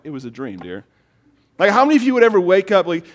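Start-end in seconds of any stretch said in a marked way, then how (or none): 0.0-0.8 low, hushed retort to a wife; soft, low mock-patient husband; placating husband. Husband answering wife upset by her dream, quietly placating.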